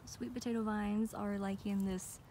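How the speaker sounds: ordinary talk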